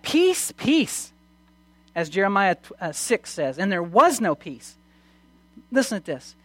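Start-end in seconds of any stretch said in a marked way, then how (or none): 0.0-1.1 high-pitched proclaiming cry. Acted. False prophets proclaiming peace to Israel, crying out. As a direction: call it out high, like a prophet to the people. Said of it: "they cried out".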